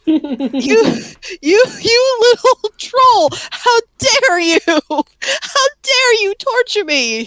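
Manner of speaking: high, slow, trembling outrage; shaking with anger. Retold or imitated. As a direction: sputter in furious disbelief. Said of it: "I'm like".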